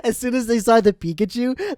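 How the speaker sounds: ordinary talk, nothing marked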